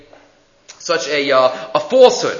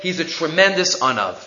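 usual speaking voice

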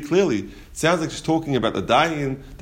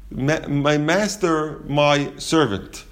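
slow plea; 2 semitones higher, much slower than usual. Retold or imitated. Woman's garbled plea.

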